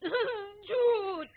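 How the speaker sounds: put-on high, loud, drawn-out childish protest; whiny disbelief. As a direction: wail it like a kid. Child protesting grandfather's joke.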